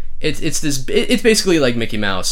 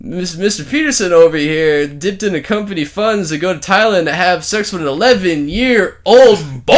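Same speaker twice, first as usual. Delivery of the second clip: Ben Affleck accusing; 6 semitones higher, 4 dB louder than usual. Retold or imitated. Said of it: "Ben Affleck does the".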